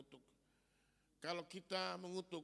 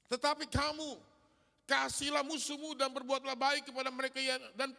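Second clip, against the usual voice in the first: loud, high sermon delivery with the words driven out; fiery preacher reading the verse aloud; full-force preaching; 6 semitones higher, 10 dB louder than usual. Acted. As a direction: preach it to the back of the church.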